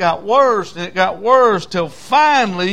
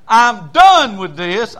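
loud, drawn-out declaration of quitting; fed-up outburst; 3 semitones higher, 7 dB louder, slower than usual. Acted. Jeremiah at the end of his rope, loud exasperation. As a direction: burst out slowly and forcefully.

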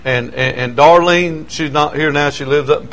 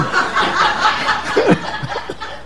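breathless laugh; 7 dB quieter than usual. Real, not acted. Punchline laugh.

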